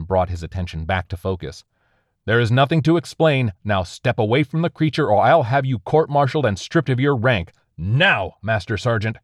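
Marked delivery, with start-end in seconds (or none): 2.2-9.2 raised, higher barked orders; an officer yelling down a subordinate; commanding shout. Acted. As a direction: threaten him at full volume. Hazmat officer orders soldier away.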